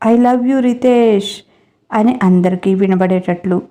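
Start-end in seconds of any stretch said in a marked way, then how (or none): 0.1-1.4 drawn out, slow call for all to hear; mild mock shout, stretched slowly; slow declaration called out to the room. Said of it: "గట్టిగా అరిచి చెప్పి".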